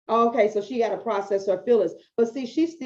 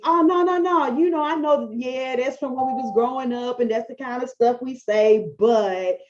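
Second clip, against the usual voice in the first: defensive excuse. Retold or imitated. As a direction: brush off the correction, justifying yourself. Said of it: "I could have done one of these".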